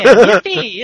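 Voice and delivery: loud, high "Yippee!"; mock cheer. Reading fanfic aloud to a friend, loud mock cheer. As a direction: bellow it like a cartoon cheer.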